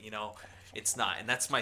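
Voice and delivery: normal talking voice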